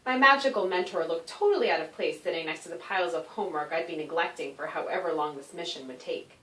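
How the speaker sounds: plain reading voice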